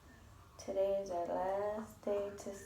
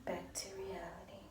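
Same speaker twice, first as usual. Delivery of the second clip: whispering; 10 dB quieter than usual. Real, not acted. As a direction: whisper so she stays asleep. Early morning whisper.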